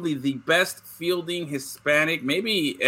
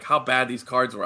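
ordinary talk

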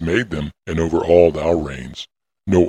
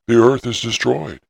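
higher-pitched, restrained cry of alarm; understated outcry with raised pitch; 6 semitones higher than usual. A narrator's reading. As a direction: cry it out as narrated dialogue, pitched up. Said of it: "I cried out and said".

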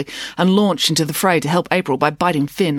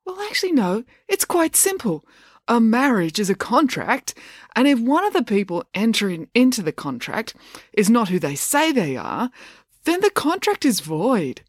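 put-on pedantic know-it-all boy; 7 semitones higher than usual.

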